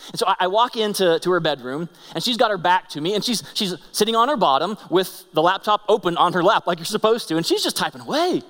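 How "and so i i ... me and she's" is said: ordinary talk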